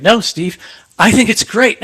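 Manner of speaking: insistent acted-out counterargument; insistent pushback. Retold argument, standing up for idea.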